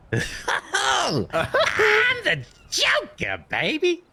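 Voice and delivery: high slow clown drawl; Joker voice. Acted. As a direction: stretch it out like a mad clown. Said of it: "I like that".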